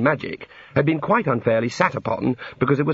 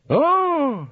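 high-pitched "ah" of discovery; god noticing visitors. A narrator's reading.

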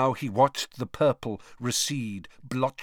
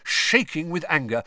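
usual speaking voice

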